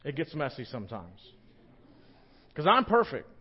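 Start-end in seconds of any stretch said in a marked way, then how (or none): 2.5-3.3 loud, high mock brag about being perfect; joking boast to the congregation. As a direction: puff up as if flawless, joking. Joking self-praise aside.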